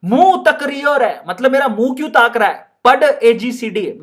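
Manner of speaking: put-on the teacher telling off the boy in Rajasthani; Rajasthani madam. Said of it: "madam कहती है". (Rajasthani madam की डांट)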